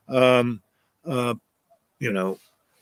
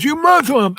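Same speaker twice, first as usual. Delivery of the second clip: high, loud sneering jab in a put-on voice; hostile accusation; 13 semitones higher, 5 dB louder than usual. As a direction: spit it out at the Indian professor. Angry truck guy accuses driver.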